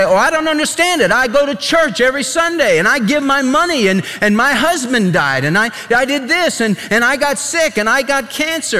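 high, fast complaining voice; mock a self-pitying churchgoer; whining churchgoer. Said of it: "they say".

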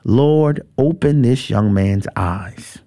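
low, slow, measured prayer; imitating a prophet's calm command; calm yet direct. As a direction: answer panic with unhurried, low-pitched composure. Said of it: "very calmly yet directly says".

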